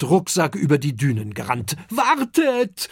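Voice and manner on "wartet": high, loud call from afar. A narrator's reading. Oskar runs over the dunes calling the others to wait, loud. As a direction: shout it while running up. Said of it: "ruft er".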